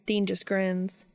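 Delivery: plain reading voice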